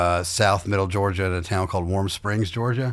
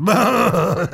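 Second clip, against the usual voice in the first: mock awkward uh; 10 semitones higher, 4 dB louder than usual. Imitating the audience's reaction.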